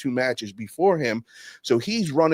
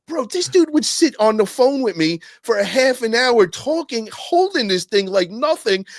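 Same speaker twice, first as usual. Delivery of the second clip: pitched way up, a little louder, amazed; stunned disbelief; 10 semitones higher, 5 dB louder than usual. Retold or imitated. Struggling on the machine, high amazed disbelief. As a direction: marvel that he made it look easy.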